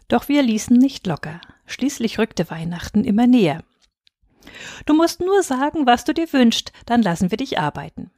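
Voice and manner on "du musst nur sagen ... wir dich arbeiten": put-on high, wheedling child plea; coaxing girls. A narrator's reading. Girls wheedling their busy father for a wish. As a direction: coax him sweetly.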